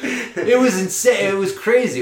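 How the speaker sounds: marvelling at the memory; amazed retelling; excited. Real, not acted. Recalling shock of choke.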